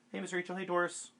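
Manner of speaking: high, bright hello to viewers; chirpy shout-out to live chat; excited greeting. Real, not acted. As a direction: greet them up high and eager.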